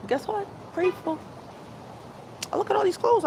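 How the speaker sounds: high little squeak of a reveal; squeaky punchline. Acted. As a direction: pop the word up high and small, teasing. Playful punchline to her grateful lesson.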